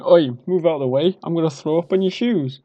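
mock drunk yell; drunken shout. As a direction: push through the crowd calling out. Drunk warning before vomiting.

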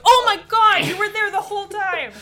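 sudden loud shocked cry; startled shout. Retold or imitated. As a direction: jump out of your skin and yell. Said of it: "you're like".

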